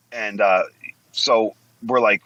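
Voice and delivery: ordinary talk, nothing marked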